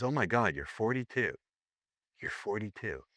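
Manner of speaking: soft, dropping to a hush; imitated quiet astonishment; stunned disbelief. Woman stunned by proof of age.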